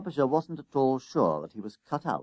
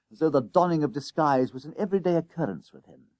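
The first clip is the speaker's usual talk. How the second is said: plain reading voice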